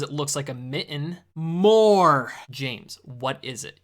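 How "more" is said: the word More shouted loud; one loud shouted More; exasperated shout. Acted. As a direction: yell More to finally get through. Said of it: "you're understanding me".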